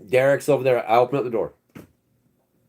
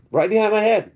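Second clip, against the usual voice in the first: pitched way up, a bit louder; alarmed emphasis; 10 semitones higher, 4 dB louder than usual. Retold or imitated. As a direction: hit it like the shock just landed. Repeats the detail, much higher.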